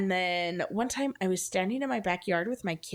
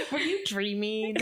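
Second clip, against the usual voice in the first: teasing impression, breaking into laughter; laughing mock disbelief; 2 semitones higher than usual. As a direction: mimic doubting parents through laughter. Laughing while mimicking doubting parents.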